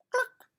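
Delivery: sharp high cluck; high hen cluck. Acted. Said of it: "And the hen said".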